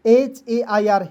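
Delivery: एच ए आई आर spelled out slowly, one letter at a time. Shown as a demonstration. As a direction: spell एच ए आई आर with gaps between letters. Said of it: "इसका उच्चारण एयर के रूप में".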